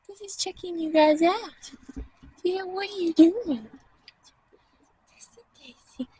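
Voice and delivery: very soft, high coo; fond pet talk. Real, not acted. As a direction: murmur sweetly up high to an animal. Cooing to her pet sheep.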